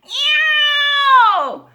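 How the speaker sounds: long, high, loud yowl; screeching cat meow. A narrator's reading. Cat screeches at the squeaky bed, loud yowl. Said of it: "screeched the cat".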